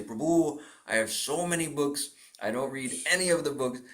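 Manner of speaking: lightly voiced Indian accent. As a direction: make excuses in an Indian accent. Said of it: "I'm doing an Indian accent".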